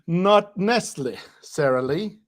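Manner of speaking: slow, puzzled reading of "not necessarily"; sounding out. Real, not acted. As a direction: piece "not necessarily" together slowly, part by part. Sounding out a written punchline.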